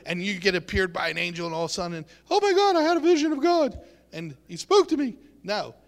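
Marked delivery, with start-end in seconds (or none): 2.3-5.2 high, loud, rushed amazement; mock thrilled exclamation. Preacher mimicking someone who saw a vision of God, hammed-up excitement. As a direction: gush it out fast and high, hammed-up.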